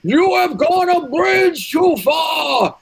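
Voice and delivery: high, slow booming command; commanding shout. Acted. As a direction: bellow it like a tyrant king. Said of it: "and I say".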